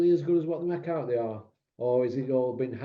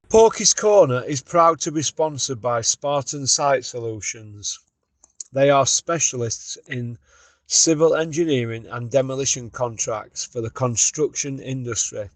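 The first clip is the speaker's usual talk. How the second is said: louder, slow, announcer-style ad read; sponsor ad read; 8 dB louder than usual. Acted. Podcast host reading the sponsor message, announcer style. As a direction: sell it like a radio sponsor spot.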